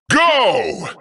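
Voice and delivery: ordinary talk, nothing marked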